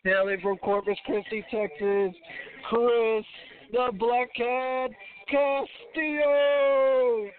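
booming ring announcer, drawn-out name; announcer mode. Pretend. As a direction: call him into the arena like a ring announcer. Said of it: "I'm gonna go announcer mode".

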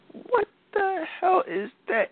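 slow, very high shrieking mom; a shocked mother screeching at a tattoo; mom's shriek. Retold or imitated. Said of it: "my mom was like".

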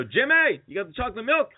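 high-pitched holler from a window; mock calling out. Friend yelling from the car window, high mock holler. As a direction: imitate shouting to someone outside. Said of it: "roll down the window".

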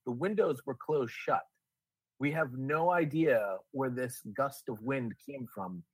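ordinary delivery

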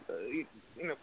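hoarse voice nearly gone; voice giving out. Real, not acted. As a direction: voice cracking and fading away. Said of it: "Can't talk today. Losing my voice here".

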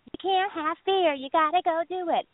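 pushy dating coach. Retold or imitated. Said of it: "pushing everybody and busting everyone's balls saying".

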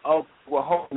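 usual speaking voice

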